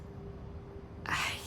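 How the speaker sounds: whispered, weary exhale; breathy sigh of resignation; soft sigh. Real, not acted. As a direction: let out a tired breath before speaking. Weary sigh before hard admission.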